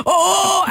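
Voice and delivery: put-on high shaky whimper of a scared boy; retold teen's frightened oh, high; imitated scared whimper. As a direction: mimic the kid's frightened cry. Kid shaking with bird on lap.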